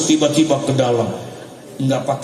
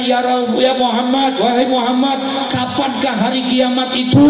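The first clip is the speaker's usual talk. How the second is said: much higher, insistent calling voice; acted young questioner; 10 semitones higher than usual. Young man asking Muhammad about Judgment Day, acted high call. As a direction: put the question high, as the intruding youth. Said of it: "Ketika anak muda itu tanya".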